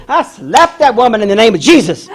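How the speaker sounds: loud, high comic proclamation; righteous boast. Retold or imitated. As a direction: declare it with mock righteous fervour. Retelling midwife's comic righteous boast.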